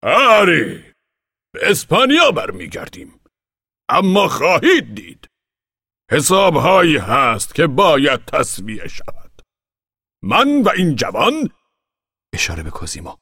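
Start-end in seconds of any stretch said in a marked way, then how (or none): 0.0-11.5 put-on high, loud, slow old man's fiery oration; fiery speech. Old man promises revenge to the crowd, fiery high voice. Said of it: "سخنرانی پرشوری".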